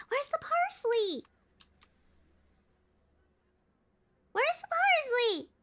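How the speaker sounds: high-pitched searching whine; exasperated searching. Real, not acted. Player searching the kitchen for parsley, exasperated.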